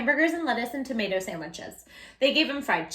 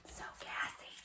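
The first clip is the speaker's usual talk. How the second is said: breathy whispered joke; whispered aside; 17 dB quieter than usual. Real, not acted. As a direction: lean in and whisper it. Aside about the dog.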